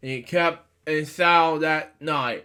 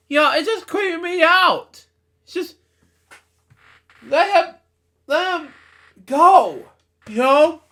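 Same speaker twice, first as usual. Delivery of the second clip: much higher, half-laughing disbelief; creeped out; 11 semitones higher, 4 dB louder than usual. Real, not acted. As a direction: plead to let him go. Reader's outburst about the story.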